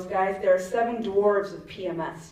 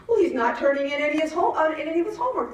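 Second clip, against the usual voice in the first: teacher's complaint; 8 semitones higher, faster than usual. Retold or imitated. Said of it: "the teacher says".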